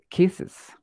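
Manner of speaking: slow "kisses" with the extra vowel clearly heard before the final s; "kisses" said slowly, showing the vowel between the s sounds; added vowel. Shown as a demonstration. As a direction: stretch out "kisses" so the added syllable stands out. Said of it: "esa vocal se siente en la pronunciación".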